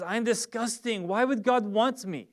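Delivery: the inner voice of someone who feels worthless; self-loathing inner voice. Retold or imitated. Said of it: "a voice in you that says".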